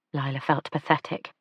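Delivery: ordinary delivery, nothing marked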